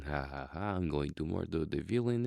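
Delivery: Russian henchman with a spelled-out evil laugh; hammed-up Russian villain's sidekick. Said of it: "sounds like an evil henchman".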